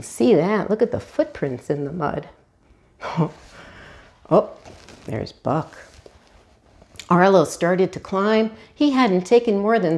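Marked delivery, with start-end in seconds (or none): none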